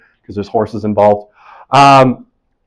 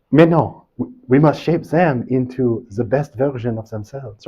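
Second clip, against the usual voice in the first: put-on French accent; 5 dB quieter than usual. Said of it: "in a terrible French accent".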